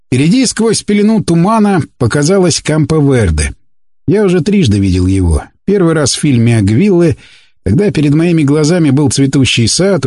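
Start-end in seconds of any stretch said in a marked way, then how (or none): none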